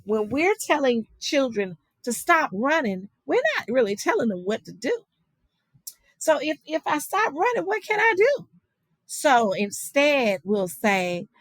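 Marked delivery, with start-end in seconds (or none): 6.2-8.5 much higher, lightly voiced little kid asking; a hint of a bewildered child's voice; puzzled child. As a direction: play the confused child who was just told to stop. Teacher voicing a puzzled child.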